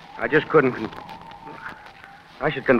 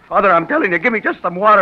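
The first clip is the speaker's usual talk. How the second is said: insistent demand; 6 semitones higher, 5 dB louder than usual. Acted. Son demands water.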